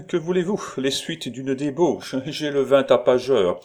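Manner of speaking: high, merry, rowdy excuse; redoubled gaiety. Acted. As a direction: make a jolly show of being tipsy. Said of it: "un redoublement de gaieté en disant".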